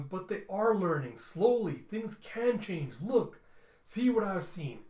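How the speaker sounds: high, loud, drawn-out plea; earnest pleading. Retold or imitated. Pleading with the Descenders.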